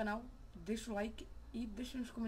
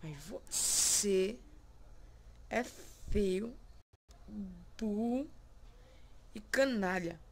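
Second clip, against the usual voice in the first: louder, slow, drawn-out insults; mock insults; 4 dB louder, much slower than usual. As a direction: lay the insults on the reflection slowly and loudly. Insulting his reflection. (insultando o reflexo, mais alto e devagar)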